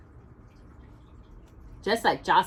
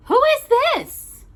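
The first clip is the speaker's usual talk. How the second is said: much higher, louder startled cry; surprised Mother Bear; 9 dB louder, slower than usual. Acted. Mother Bear finds a strange bear at home, startled. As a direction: gasp it out in surprise.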